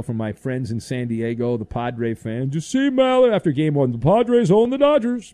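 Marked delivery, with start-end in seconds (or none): 2.5-5.3 raised, high-pitched imitation of a boastful fan; hammed-up sports-fan email read; mock fan voice. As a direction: crow it like a gloating Padres fan. Reads fan's boastful email aloud.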